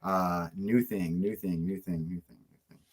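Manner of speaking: slow repetition fading to very soft; muttering to self. Real, not acted. Fighter searching for an answer to the quiz, muttering to himself.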